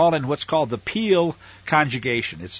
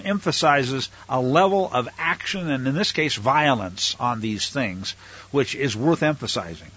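usual speaking voice

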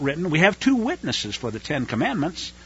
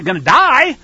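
sudden loud, high burst of warning; raised-voice prophetic warning; stern warning; 7 semitones higher, 7 dB louder than usual. Retold or imitated. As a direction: hit the threat hard and loud. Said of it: "he warned those in the south".

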